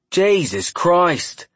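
loud, drawn-out Cockney exclamation; shocked Cockney exclamation, slow; Cockney accent. A narrator's reading. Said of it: "loudly exclaimed in a broad Cockney accent".

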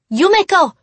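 sharp loud call; snapped. Acted. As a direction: snap her name to get attention. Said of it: "Reika snapped".

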